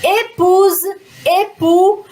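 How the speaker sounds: épouse and époux starting on a closed "ay"; ÉPOUSE, ÉPOUX with a clear closed first vowel. Shown as a demonstration. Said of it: "fica E, então".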